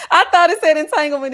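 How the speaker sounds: said through loud laughter; laughing. Real, not acted. Laughing at her own misreading.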